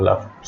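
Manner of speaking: "লাফড" said as "laft"; final -ed as T. Shown as a demonstration. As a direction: end "লাফড" with a t, no d. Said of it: "এখানেও ইডির উচ্চারণ হবে ট". ("লাফড"-এর শেষে ট উচ্চারণ)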